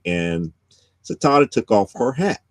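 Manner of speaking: ordinary talk, nothing marked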